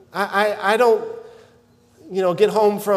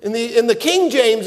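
ordinary talk

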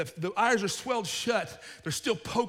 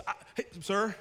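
usual speaking voice

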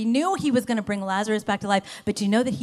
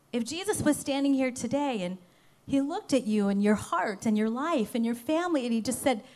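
ordinary delivery

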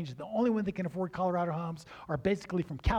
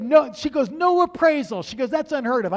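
astonished; 8 semitones higher, 8 dB louder than usual. Retold or imitated. Realtor astonished, retold.

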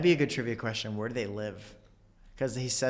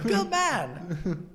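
Walken impression; 6 semitones higher than usual. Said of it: "thinking Wedding Crashers, Chris Walken".

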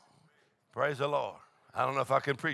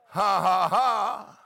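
laughter; 5 semitones higher, 5 dB louder, much slower than usual. Real, not acted. Holy laughter in sermon.

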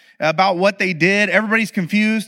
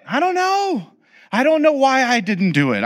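lightly voiced child, higher and bewildered; sheepish kid; 7 semitones higher than usual. A parent voicing the child who bailed on the ride, sheepish. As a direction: play the kid who chickened out, a touch higher.

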